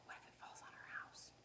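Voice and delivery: fast, fearful whisper; worried whisper. Retold or imitated. Imitating anxious Wemberly, whispered fast. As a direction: whisper the worry quickly. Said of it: "She's like".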